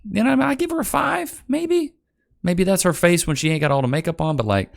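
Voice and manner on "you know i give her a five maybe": mock letter to mom. Streamer mocks writing home to mom about her, high sarcastic voice. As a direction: parody a guy gushing to his mother, then shrug her off. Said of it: "I'm not writing home to my mom".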